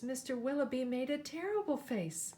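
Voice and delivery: usual speaking voice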